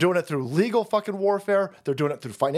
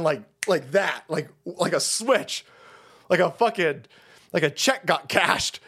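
ordinary talk, nothing marked

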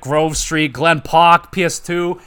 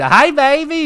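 high, loud sultry imitation; mock stripper voice; 13 semitones higher, 6 dB louder, slower than usual. Gamer imitating the game stripper greeting CJ, high mock sultry voice. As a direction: greet him in a hammed-up high come-on.

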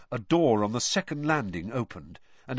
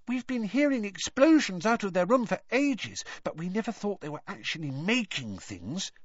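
higher, lively little sister voice; lightly voiced young girl; 11 semitones higher than usual. A narrator's reading. Younger sister telling Harry about the twins, lightly voiced girl. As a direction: play the younger sister, bright and chatty.

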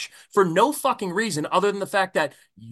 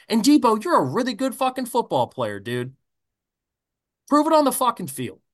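ordinary delivery

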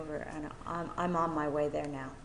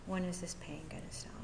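softer, quicker retold plea; hushed plea; 8 dB quieter, faster than usual. Newcomer asking her sponsor about pain, hushed. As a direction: let the question sink towards a whisper.

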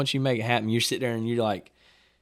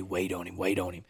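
hushed urging; 5 semitones lower, 9 dB quieter than usual. Retold or imitated. Telling dad to hold.

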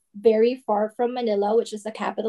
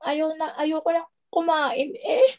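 higher Mindoro Tagalog, whiny at the end; Mindoro accent; 6 semitones higher than usual. Shown as a demonstration. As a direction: play a fussy kid in a Mindoro accent. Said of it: "Can you try one sentence?".

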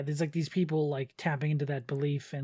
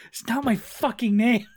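loud, higher indignant outburst; angry god; 6 semitones higher, 8 dB louder than usual. Retold or imitated. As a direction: snap it like an offended god. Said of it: "and it's like".

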